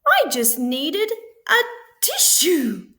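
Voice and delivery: high, loud sniffling plea; sniffly wolf. A narrator's reading. Sick wolf explains to the pigs, sniffling. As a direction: whimper it through a blocked nose. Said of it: "sniffled Big Bad".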